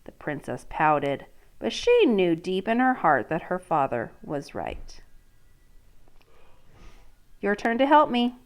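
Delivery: reading a picture book to a class; storybook read-aloud voice; story narration. Acted. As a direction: let the princess's dread come through. Teacher reads story aloud.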